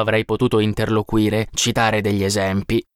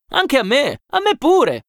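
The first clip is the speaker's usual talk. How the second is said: high-pitched overlapping-style chorus of voices; eager echoing agreement; 3 dB louder, slower than usual. A narrator's reading. Other workers echo their mate, eager high agreement. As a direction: chime in quickly, pitched well up.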